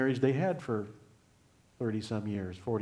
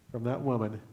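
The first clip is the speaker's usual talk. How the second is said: ordinary delivery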